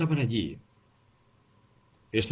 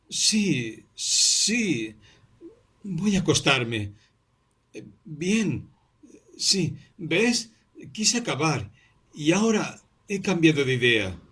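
feverish friend; 7 semitones higher, much slower than usual. A narrator's reading. Feverish Vasia answers.

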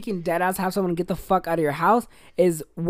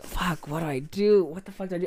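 quiet, fading panicked self-talk; under your breath, at a loss; panicked mutter; 3 semitones lower, 8 dB quieter than usual. Retold or imitated. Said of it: "And I'm like".

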